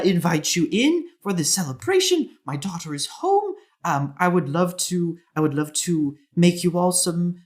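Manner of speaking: high, eager hostess voice, stumbling over the invitation; dwarf mother. Acted. Mother inviting her daughter's friends to celebrate, eagerly. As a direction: press the guests to come in. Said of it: "and she says".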